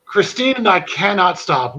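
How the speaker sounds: ordinary talk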